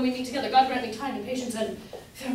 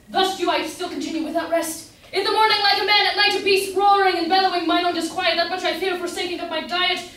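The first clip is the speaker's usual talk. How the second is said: louder and higher, roaring out his misery; hammed-up lovesick ranting; 6 semitones higher, 7 dB louder than usual. Humphrey declaiming his love torments to Luce, loud and high. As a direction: overact the lovesick beast, loud.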